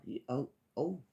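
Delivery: normal talking voice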